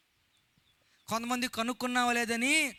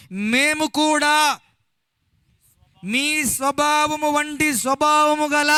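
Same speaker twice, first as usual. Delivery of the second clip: slow, sustained proclamation on a raised tone; declaimed scripture reading; 4 semitones higher, 3 dB louder, slower than usual. Acted. Preacher reading Paul's story to the congregation, slow and declamatory. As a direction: build to a loud, held cry at the end. Reads the scripture passage aloud.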